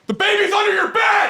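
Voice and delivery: very high terrified shriek; piercingly high night-terror scream. Retold or imitated. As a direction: shriek it as a dire warning. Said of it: "he screamed the five scariest words".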